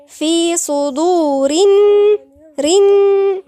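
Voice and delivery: slow, loud recitation of في صدور; silent-lam recitation. Shown as a demonstration. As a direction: run صدور straight into a held "rinn" hum, no l. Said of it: "ما ننطقش اللام".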